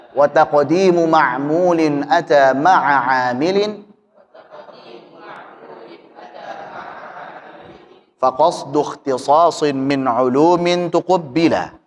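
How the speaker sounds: slow measured verse recitation; bacaan bait nazam. Shown as a demonstration. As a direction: recite each line in a slow, even chant. (membaca bait dengan pelan berirama)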